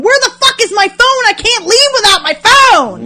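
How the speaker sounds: high, loud panicked shout; frantic yelling. Retold or imitated. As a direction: re-enact yourself yelling in a frantic search. Acts out her frantic phone search.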